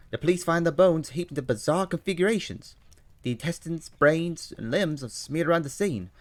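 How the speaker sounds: put-on high, loud London-accented Holmes; eager detective reading the news aloud. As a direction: play Holmes, keen and bright over the paper. Holmes reads grisly news.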